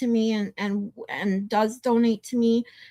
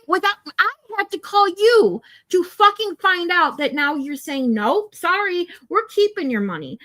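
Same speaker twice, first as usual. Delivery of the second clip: heated, higher-pitched angry rant; getting worked up; 5 semitones higher than usual. Real, not acted. Customer berating phone company for keeping money, worked up. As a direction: relive the argument, real indignation rising in pitch. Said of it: "I'm sorry I'm getting worked up".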